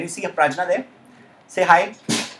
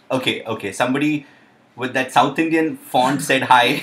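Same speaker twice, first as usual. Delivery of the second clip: ordinary talk, nothing marked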